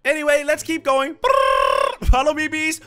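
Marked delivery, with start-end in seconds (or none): 1.2-2.1 deep rolled buzzing noise; low bee buzz. Pretend. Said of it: "I don't think I can keep doing this".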